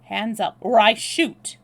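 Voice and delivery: loud, sudden command at gunpoint; sudden menacing bark; abrupt threat. A narrator's reading. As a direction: order a surrender, building to a loud finish. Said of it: "with an abrupt change of manner".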